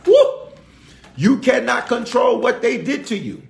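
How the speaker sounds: normal talking voice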